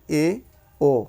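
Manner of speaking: ए and ओ with mouth half closed; half-closed vowels. Shown as a demonstration. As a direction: sound ए, then ओ, each apart. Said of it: "मुख द्वार का आधा बंद होना".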